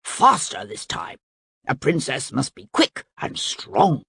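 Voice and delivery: ordinary delivery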